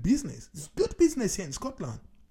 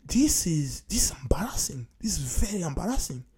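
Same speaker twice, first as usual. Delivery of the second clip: whispered under the breath; ashamed whisper; embarrassed whisper; 3 semitones lower than usual. Real, not acted. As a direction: hush it like a confession. Embarrassed aside about the league.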